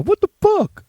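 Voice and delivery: high, loud incredulous squeal; hammed-up shocked dad; imitating appalled dad. Dad reacting to his kid, loud mock disbelief. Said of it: "And the dad's all".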